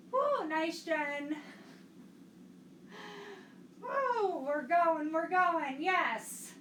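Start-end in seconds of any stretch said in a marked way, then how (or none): 3.8-4.4 high cheering woo; excited whoop. Real, not acted. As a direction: whoop them on. Cheering class on.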